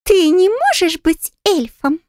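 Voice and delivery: high, slow skeptical pixie drawl. A narrator's reading. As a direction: drawl it out in doubt, fairy-like. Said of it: "скептически протянула Пикси".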